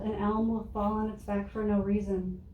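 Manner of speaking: ordinary delivery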